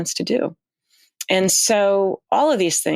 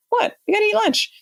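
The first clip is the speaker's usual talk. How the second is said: high, incredulous "You gotta eat lunch"; mock Paolo; 14 semitones higher than usual. Astronaut imitating Paolo insisting on lunch, high mock disbelief. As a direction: tease him as an amazed Italian. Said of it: "I'm making fun".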